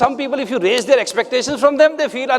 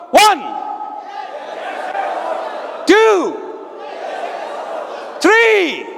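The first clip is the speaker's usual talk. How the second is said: shouted count; 8 semitones higher, much slower than usual. Real, not acted. Counting the audience up and down.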